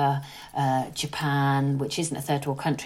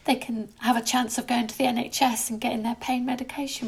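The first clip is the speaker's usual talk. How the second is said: tearful; 7 semitones higher than usual. Real, not acted. Upset talking about suffering women.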